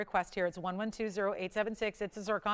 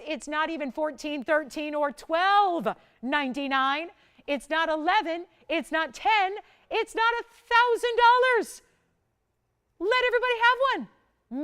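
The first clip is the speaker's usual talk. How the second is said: builds from eager to near-shouting, much higher and louder; rising excitement; 6 semitones higher, 7 dB louder than usual. Real, not acted. Shopping host to viewers, hyping the price drop, climbing to an excited shout. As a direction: stack each price cut until it bursts into a shout.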